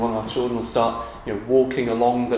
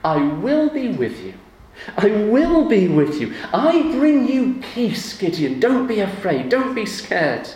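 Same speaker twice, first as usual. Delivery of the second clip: higher, louder, slow earnest reassurance; God reassuring Gideon; 7 semitones higher, 6 dB louder than usual. Retold or imitated. God promising Gideon he is with him, earnest and strong. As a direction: speak peace over a frightened man. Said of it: "he says to Gideon".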